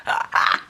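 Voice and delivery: short breathy burst of laughter; cracked-up laugh. Real, not acted. Vlogger shows an item that cracked her up, real laugh. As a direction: burst out laughing before explaining. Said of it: "this cracked me up".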